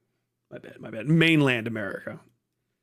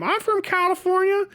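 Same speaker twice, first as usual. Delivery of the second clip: much higher dim desert local, proud of it. Retold or imitated. Answering in the hick voice.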